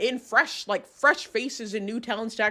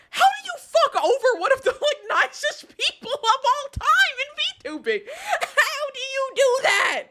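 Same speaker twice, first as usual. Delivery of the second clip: outraged cry; 12 semitones higher than usual. Real, not acted. Streamer asks chat how anyone could betray her, high outraged cry. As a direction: plead in outraged disbelief. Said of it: "but I'm mad".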